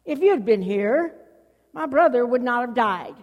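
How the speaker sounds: loud, accusing grief; acted outburst of anger and frustration; angry, frustrated cry. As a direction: hurl the reproach at full voice. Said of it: "Do you hear some anger and frustration in her voice?".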